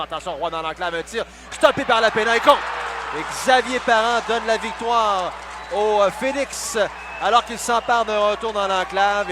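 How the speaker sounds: goal call. Real, not acted. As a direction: shout the winner. Overtime winning goal call.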